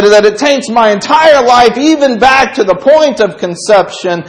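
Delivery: loud, high pleading in David's voice. Retold or imitated. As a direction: beg God not to leave, at full force. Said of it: "he's pleading".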